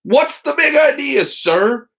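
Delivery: high, sharp mock-scolding exclaim. A narrator's reading. Mother exclaiming at her son's zigzag coast. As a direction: throw the question up high in surprise. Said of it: "she exclaimed".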